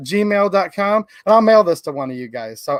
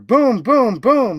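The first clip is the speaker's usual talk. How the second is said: higher, swelling boom on each beat; thunder booms; 3 semitones higher, much slower than usual. Acted. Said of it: "Mister Brown makes thunder".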